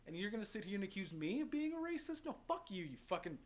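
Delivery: reenacted outrage, rising in pitch; angry. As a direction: confront someone who wrongly accused you. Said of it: "but he made me mad".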